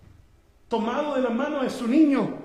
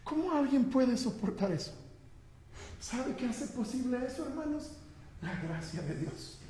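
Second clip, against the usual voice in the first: very soft, dropping lower; solemn hush; 3 semitones lower, 12 dB quieter than usual. Real, not acted. Preacher tells congregation of the martyrs and grace, hushed. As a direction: speak in awe, barely voiced.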